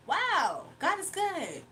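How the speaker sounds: high, lilting Jamaican-sounding accent. Real, not acted. Streamer exclaims God is good to viewers, in a Jamaican-sounding accent. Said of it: "Now she sounds like Jamaica".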